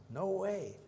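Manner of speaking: voicing Paul as if the idea is crazy; incredulous Paul. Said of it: "Again, Paul says".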